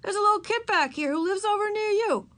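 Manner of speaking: put-on high-pitched boyish call; yelling. A narrator's reading. Boy telling Henry about his little neighbour, yelling. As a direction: call the news across the playground. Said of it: "yelled the boy".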